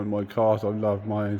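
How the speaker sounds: normal talking voice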